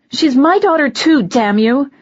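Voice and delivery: high, loud furious shout; angry outburst. Acted. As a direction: snap and yell it at him. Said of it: "Something inside me snapped".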